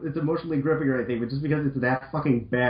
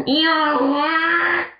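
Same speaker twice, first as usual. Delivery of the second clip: much higher, louder, slow hammed-up yell; all-caps shout; 11 semitones higher, 6 dB louder, much slower than usual. Reading an all-caps line aloud, loud mock yell. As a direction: belt it out like the caps demand. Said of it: "It's all caps, so I gotta make a lot of noise".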